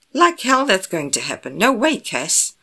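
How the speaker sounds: loud, high, alarmed protest; indignant refusal. Acted. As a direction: flatly forbid it, outraged. Refuses to let partner go instead.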